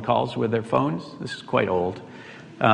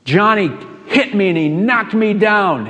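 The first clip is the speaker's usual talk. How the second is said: put-on high, loud child tattling to mom; a kid running home to tell on Johnny; much higher, louder whiny child voice; little boy complaining; 8 semitones higher, 7 dB louder, slower than usual. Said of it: "Billy comes home and says to his mom".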